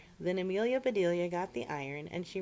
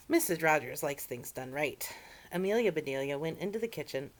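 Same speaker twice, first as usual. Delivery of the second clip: ordinary delivery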